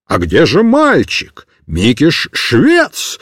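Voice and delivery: very deep, slow baffled bark; astonished sergeant. A narrator's reading. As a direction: demand an answer. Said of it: "закричал он цыгану".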